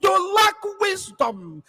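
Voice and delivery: slow scolding yell from the pulpit; rebuking shout. Real, not acted. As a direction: shout it down at them in scorn. Rebuking listeners in a broadcast.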